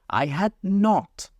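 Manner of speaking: high, slow sharp retort; sharp angry cry. A narrator's reading. Mouse angrily denying to Alice, high and sharp. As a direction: snap it back angrily. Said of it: "cried the mouse sharply and very angrily".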